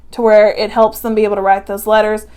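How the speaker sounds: ordinary talk, nothing marked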